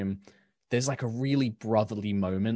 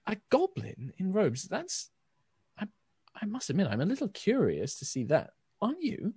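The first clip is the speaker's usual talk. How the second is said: a higher, curious sword-soul voice, teasing gently; high, lilting brother voice; 11 semitones higher than usual. Acted. Brother's soul teasing his sibling, higher and lightly curious.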